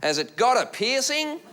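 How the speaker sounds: slow Aussie question, rising high at the end; Aussie heckler. Retold or imitated. Aussie three rows back questioning the comic, imitated rising heckle. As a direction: imitate an Australian heckler asking in drawn-out curiosity. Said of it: "who was an Aussie".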